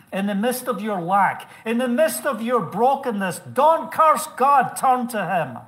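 loud, high, rising exhortation; forceful preaching. Real, not acted. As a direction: build to a shouted plea to the congregation. Same message preached at full force.

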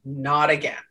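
low, weary "not again"; eye-rolling sigh. Retold or imitated. Exasperated at the group post, weary eye-roll. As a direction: roll your eyes at it. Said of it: "roll my eyes and go".

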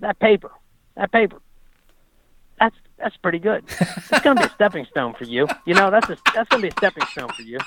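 imitating Frankel. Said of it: "he said".

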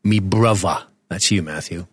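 lightly put-on London accent; mock London accent. Podcaster claims his co-host as brother, in mock London accent. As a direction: play at a Cockney lad. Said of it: "my Jason Statham sort of London thing".

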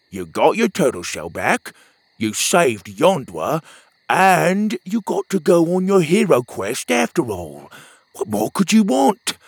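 pep up a gloomy buddy; upbeat friend. Acted. Petey cheering up Pockets, bright friend voice.

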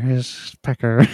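Jennifer Coolidge impression. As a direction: lean further into the Coolidge drawl. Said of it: "It's Jennifer Coolidge".

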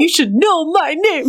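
high, laughing, pushy introduction; exuberant mock-aggressive. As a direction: ham up the demand through laughter. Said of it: "This is my own exuberance".

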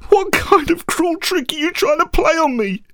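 very high, anguished accusation; high-pitched hurt outrage; tearful anger. A narrator's reading. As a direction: accuse through tears, voice strained upward. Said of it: "with tears streaming down my face".

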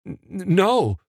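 cornered man stammering a denial; stammered. A narrator's reading. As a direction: trip nervously over the N. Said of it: "Evan stammered".

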